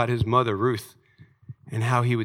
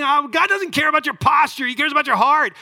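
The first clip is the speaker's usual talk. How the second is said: mocking shout; 8 dB louder than usual. Preacher mocking a saying he is tired of, high mocking shout.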